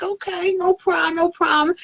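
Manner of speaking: high, sweet, overly agreeable reply; fake agreeable. Woman pretending to help her rival, high and sugary. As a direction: play along innocently. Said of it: "I was like".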